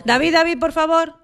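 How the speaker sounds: urgent moderator restoring order; calling for order. Real, not acted. Moderator calls the panel to order.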